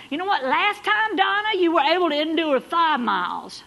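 loud, high, driving preacher climb; preaching at full force. Acted. Pastor rallying the runners in the pews, loud and high. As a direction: lift the congregation with rising fervour.

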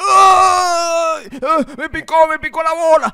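high, loud hammed-up wailing; mock crying. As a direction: wail in mock pain. Le picó algo, llora gritando. (llanto exagerado de dolor)

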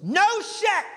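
very high, blurted shriek; mock heckler yell. Imitating a woman heckling the comic, high yell. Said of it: "She yells this out".

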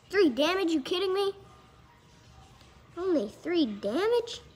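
loud, high-pitched disbelief; incredulous. Real, not acted. Card collector reacting to a weak card, incredulous.

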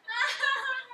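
a sudden high laugh at his own joke; laughing at his own pun; high burst of laughter. Real, not acted.

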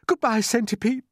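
high-pitched desperate goodbye; gasped farewell. A narrator's reading. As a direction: call goodbye as a boy facing death. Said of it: "gasped James".

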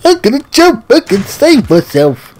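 high, mocking whiny voice of a jumping player; mocking enemy voice. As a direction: mimic the jumper, high and loud.

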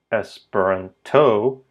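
"Esperanto" as es-pe-ran-TO, last syllable stressed; slow "Esperanto", rising onto a heavy TO; wrong final stress. Shown as a demonstration. Teacher showing learners a mistake, stress on TO. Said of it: "It's not Esperanto or".

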